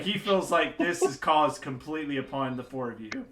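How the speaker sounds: ordinary delivery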